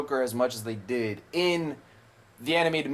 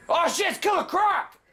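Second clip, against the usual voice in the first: excited exclamation; 3 dB louder than usual. Real, not acted. Spotting a villain in game.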